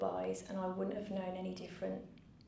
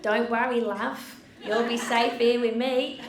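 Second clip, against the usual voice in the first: put-on loud, higher Australian accent; 4 semitones higher, 12 dB louder than usual. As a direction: belt out a broad Aussie reassurance. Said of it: "I've gotta do your accent".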